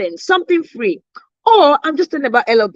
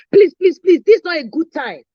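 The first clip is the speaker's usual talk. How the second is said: frazzled pleading; 5 semitones higher than usual. Retold or imitated. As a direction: beg to be left alone, at the end of her rope. Stressed client brushing off caller.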